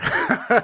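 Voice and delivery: a sudden high laugh. Real, not acted. Laughs at joke.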